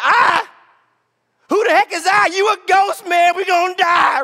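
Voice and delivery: high, loud frightened protest; hammed-up alarm at a ghost; mock panic. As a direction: act out a panicked shout. Imagined frightened passengers on the boat.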